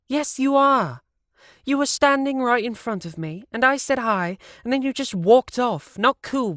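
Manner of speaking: high, loud indignant woman; high-voiced annoyed reproach; Brenda scolding. A narrator's reading. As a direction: Brenda scolding Leon for walking off.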